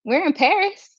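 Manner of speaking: high, bright mock cheer of a TV show; mock cheerful announcement. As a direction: sing it out playfully like the show revealing Paris. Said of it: "if they said".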